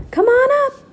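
high, loud excited shout. Acted. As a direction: call him up the ladder. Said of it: "Annie shouted".

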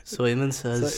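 soft go at an Irish accent. Shown as a demonstration. Prompting a friend with an Irish accent, softly. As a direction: quietly try it in Irish accent. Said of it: "Can you do Irish?".

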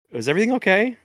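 high, eager server voice; manager checking in. Retold or imitated. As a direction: ask like a nervous manager. Manager voiced in retold story.

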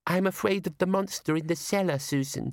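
put-on a child afraid of the cellar monster; scared little girl. Twyla frightened of monster.

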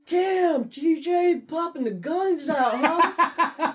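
high, loud, leering impression; creepy admiring coworker. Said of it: "anytime he saw me".

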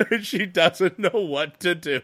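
high, loud laughing speech; can't stop giggling through it; laughing while talking. Real, not acted. Laughing while retelling the evening.

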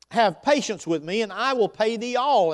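loud, high, begging plea; pleading servant. Acted. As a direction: beg for mercy again. Fellow servant pleads the same.

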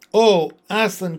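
loud half-frightened joy; children seeing the risen lion; glad, startled cry. Acted. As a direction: cry out his name in wonder. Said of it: "cried both the children".

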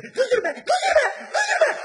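high laughing shout, rising to a shriek; panicked plea. Retold or imitated. Pregnant woman in front row shrieks at the comedian, laughing and pleading. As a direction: beg him to stop through laughter.

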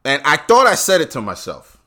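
ordinary talk, nothing marked

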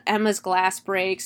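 ordinary delivery

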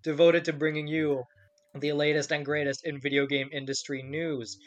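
upbeat show-opening announcer read; podcast host intro. Acted. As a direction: welcome the listeners like a radio host.

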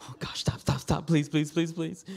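urgent whispered begging; frantic hushed pleading. Acted. Mom trying to quiet the baby, pleading in a whisper. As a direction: whisper it fast, pleading for silence. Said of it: "trying to quiet the baby like".

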